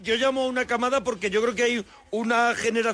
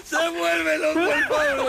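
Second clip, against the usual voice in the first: laughing tease; 6 semitones higher, much slower than usual. Real, not acted. Teasing Pablo amid laughter.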